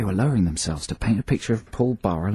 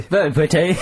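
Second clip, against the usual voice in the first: higher, mock fake-British accent; bad British accent; 4 semitones higher than usual. Co-host echoing the clip, bad British accent. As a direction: echo a badly faked British accent. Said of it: "doing what he thinks is a British accent".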